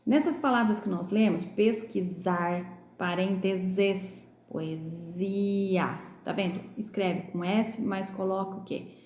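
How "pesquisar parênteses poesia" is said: slow pesquisar, parênteses, poesia with voiced Z; S pronounced as Z. Shown as a demonstration. Teacher rereading the word list, slow poesia-style stretched Z in each. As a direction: read pesquisar, parênteses, poesia syllable by syllable, buzzing the Z. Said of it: "Tem o som de Z quando a gente pronuncia".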